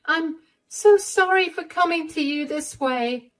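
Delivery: a sorry, shaken plea; upset young woman. Acted.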